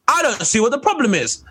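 high-pitched put-on cat lady; mock Doris. Doris answers the council about her cats, mock protest. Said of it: "And she goes".

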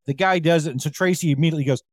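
normal talking voice

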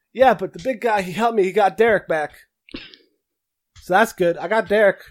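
put-on high, eager little kid; little boy voice. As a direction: speak up as a happy small boy.

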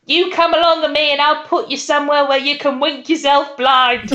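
a hammed-up angry cook; mock cook's rant. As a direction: bellow it like a bossy cook. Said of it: "culminating in".

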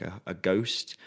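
normal talking voice

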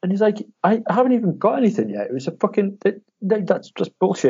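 jumps up high in indignant disbelief; retold high-pitched outrage; outraged disbelief. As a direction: squeal it like you've been robbed. Mate outraged at cancelled order.

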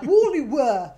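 "Whalley" said loud with rounded West Country vowels; imitating local accent. Mocking how locals say it, West Country accent. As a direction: mimic the locals saying "Whalley Weir". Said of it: "They're like".

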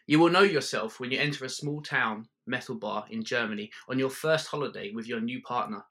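usual speaking voice

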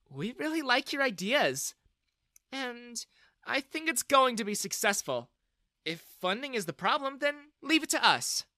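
encouraging woman investor. A narrator's reading. Woman offering to fund a founder's startup, encouraging. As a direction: pitch it up and pledge your support.

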